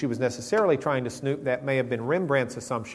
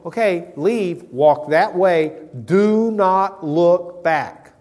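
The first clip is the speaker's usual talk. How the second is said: slow, louder, each word spaced out; slow deliberate command; 3 semitones higher, 6 dB louder, much slower than usual. Acted. Angel instructing Lot's family, slow and firm. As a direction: spell out the orders slowly.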